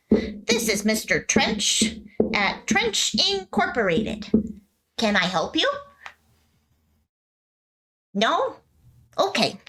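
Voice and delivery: a hint of a child playing boss; young boy on the phone. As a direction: play the little boy taking a business call.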